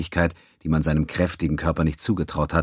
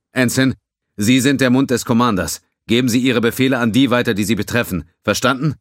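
louder, higher alien officer voice; a burly alien, worried; gruff Tellerite; 8 semitones higher, 7 dB louder than usual. Acted. Tellerite giving orders.

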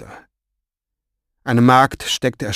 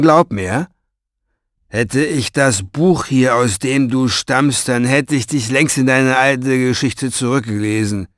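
scornful Orpheus; 6 dB louder than usual. Acted. Orpheus belittles the boy he wants rid of, scornful. Orpheus taunts and threatens Farid.

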